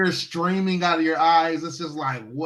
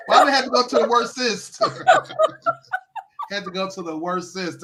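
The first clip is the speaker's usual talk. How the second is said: laughing through the words; 5 semitones higher, 3 dB louder than usual. Real, not acted. Joking with friend about worser, laughing. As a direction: giggle at her own bad grammar. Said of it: "The worser".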